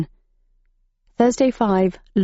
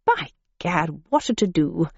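softer, unruffled posh gent; calm exclamation; 3 dB quieter than usual. A narrator's reading. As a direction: shrug it off like a teatime mishap. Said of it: "exclaimed the gentleman as he calmly".

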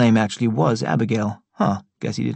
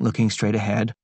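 ordinary delivery

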